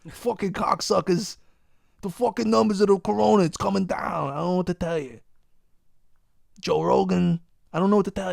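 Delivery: hammed-up Joey Diaz cursing about the numbers; ranting like Joey Diaz to mock the optimists. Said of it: "I sound like Joey Diaz".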